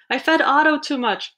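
high-pitched urgent admission; worried storybook plea; pleading confession. A narrator's reading. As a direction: own up in a mild panic. Boy confesses while begging help.

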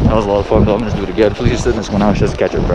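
loud, excited shouting outdoors; shouting over wind. Real, not acted. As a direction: yell over the rushing wind. Shouting over wind while tubing.

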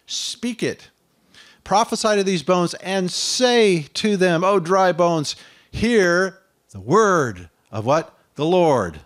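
loud, high, fervent proclamation; Isaiah crying woe is me, loud; preaching at full force. Acted.

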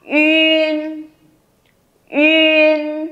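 "yun" held long on a high level tone; model yun. Shown as a demonstration. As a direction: say "yun" with rounded ü closing into n. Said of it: "vẫn đọc là".

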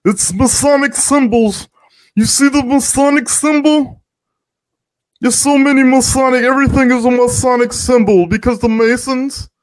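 very high, sustained put-on voice; hammed-up high-pitched mockery; mocking conspiracy caller. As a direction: mimic an obsessive alarmist in a high monotone. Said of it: "Masons be like".

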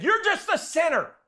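high, loud preacher accusing; a hint of a fired-up Baptist scolding; fired-up accusation. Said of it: "he was all fired up".

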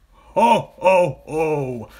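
loud, booming "ho, ho, ho"; high-pitched, hammed-up villain laugh in words; crocodile's scoffing cry. A narrator's reading. Enormous crocodile mocking the smaller one, loud scoffing cry. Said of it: "cried the enormous crocodile".

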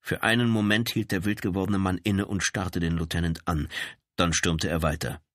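usual speaking voice

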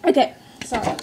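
talking after holding breath; breath held. Real, not acted. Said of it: "I gotta hold my breath".

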